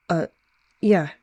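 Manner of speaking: ordinary delivery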